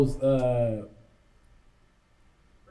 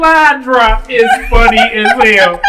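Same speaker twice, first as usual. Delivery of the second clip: laughing; 3 semitones higher, 7 dB louder than usual. Real, not acted. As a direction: say it while cracking up. Laughing reaction.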